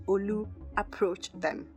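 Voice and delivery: ordinary delivery, nothing marked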